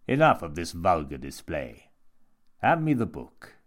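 loud, grand rebuke; imperious monk. A narrator's reading. Monk demands the book from Jimmy, loud and imperious. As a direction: command him with theatrical authority.

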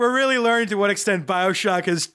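loud, laughing through the words. Real, not acted. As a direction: speak through a fit of giggles. GM jokes while laughing.

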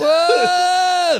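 very high, drawn-out whoa; amazed shout. Real, not acted. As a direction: react hugely to the reveal. Reacting to the Chris Pratt reveal.

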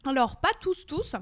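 talking around a caramel candy; chewing while speaking; mouth full. Real, not acted. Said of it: "Excusez-moi, je mange un caramba".

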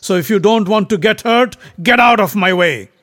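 loud, high, defiant warning; angry threat. A narrator's reading. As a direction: order him aside forcefully. Bhima threatens the monkey.

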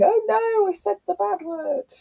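high, hammed-up gasp of alarm; mock panic. Parent mocking her own panic after swearing in front of a child. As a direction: play the parent who just caught herself swearing. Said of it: "rather than panicking and going".

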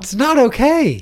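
high, slow squeaky outrage; mock outrage.

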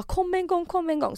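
lightly panicked, high-pitched begging; mildly frantic retold plea; acted urgent plea.

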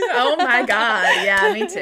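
loud, high mock outrage; exasperated shout. Real, not acted.

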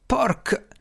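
high curse chopped mid-word; a swear word cut short; broken-off curse. A narrator's reading. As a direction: start to swear, then stop dead. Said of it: "Strange si interruppe di colpo".

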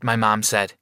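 plain reading voice

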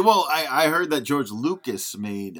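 ordinary talk, nothing marked

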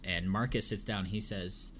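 ordinary talk

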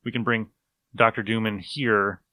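usual speaking voice

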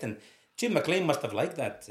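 normal talking voice

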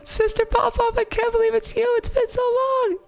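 put-on very high, tiny baby voice; baby's voice. Baby brother greeting his sister, tiny very high voice. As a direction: pipe it up in a tiny squeak. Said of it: "Baby Popov goes".

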